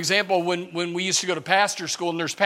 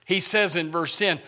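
ordinary talk

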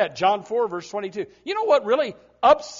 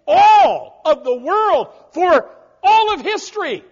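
preaching shout; 7 semitones higher, 11 dB louder than usual. Real, not acted. Preaching emphasis.

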